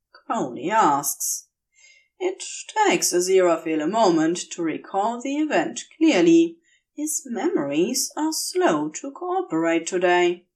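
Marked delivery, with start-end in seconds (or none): none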